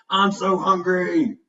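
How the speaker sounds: high, slow, drawn-out cry; excited barking dog. A narrator's reading. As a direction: bark it out like a starving, excited pup. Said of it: "running around the room barking".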